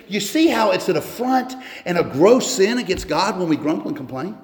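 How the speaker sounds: ordinary delivery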